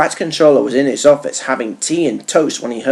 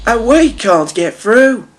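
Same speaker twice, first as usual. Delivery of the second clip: slow, raised grumble; Thomas grumbling; 9 semitones higher, 3 dB louder, slower than usual. A narrator's reading. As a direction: drag out the complaint. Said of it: "grumbled Thomas".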